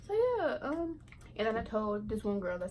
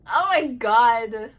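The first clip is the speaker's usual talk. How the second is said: loud, slow oh my god; real burst of surprise; 15 dB louder, much slower than usual. Real, not acted. Reading a funny text, she bursts out in loud surprise. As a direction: blurt it out, stretched and loud.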